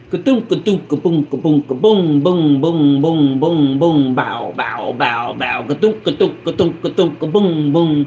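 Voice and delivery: rhythmic ka-thunk ka-boom sound effects; imitated banging noise. Neighbor retelling the noise upstairs, imitated thuds. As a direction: mimic the racket of boots overhead.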